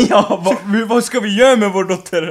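high laughing worried parent; laughing mock parent. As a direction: worry through giggles.

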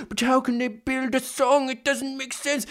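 high, small whiny voice; high-pitched mock bewilderment; mock whining. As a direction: imitate a helpless complainer, softly. Mimics a worried beginner's complaint.

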